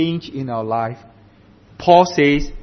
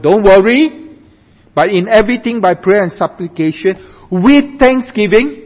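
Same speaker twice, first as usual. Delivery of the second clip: raised, loud pulpit delivery; emphatic preaching; 6 semitones higher, 6 dB louder than usual. Acted. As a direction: press the verse home to the congregation. Preaches the verse with force.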